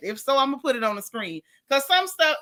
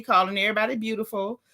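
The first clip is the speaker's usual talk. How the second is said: normal talking voice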